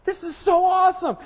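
high, shouted delight; over-the-top excited exclaiming; overjoyed. Retold or imitated. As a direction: burst out with thrilled joy. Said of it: "I would be like".

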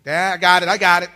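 loud, high cut-off as the son; impatient son. Retold or imitated. Son won't let dad tell him, loud impatient interruption. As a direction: imitate a son bursting in over dad's advice. Son cuts off dad's advice.

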